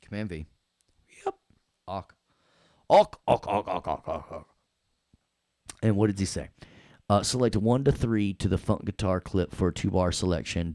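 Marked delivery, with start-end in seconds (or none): 1.9-4.5 squawks starting loud, then fading away; repeated squawk. Pretend. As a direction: squawk in a run that dies down. Spelt squawking noise while working.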